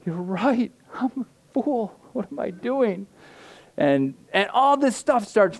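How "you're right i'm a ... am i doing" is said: high, self-mocking whine; mock self-scolding. Pastor quoting his own overslept self-talk, high mock whine. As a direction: berate yourself in a raised put-on voice. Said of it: "I'm like".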